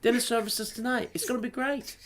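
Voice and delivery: high, very soft encouragement; imitated gentle chef; mock super-nice chef. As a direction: reassure the team sweetly. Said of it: "He's, like, super nice".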